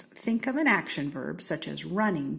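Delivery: plain reading voice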